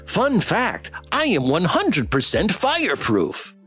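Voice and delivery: chirpy robot pet, sing-song swoops in pitch; slow, over-enunciated talking toy voice; relentlessly cheerful plush companion; perky toy pet. Acted.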